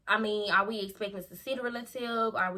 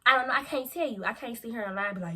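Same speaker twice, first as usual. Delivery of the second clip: normal talking voice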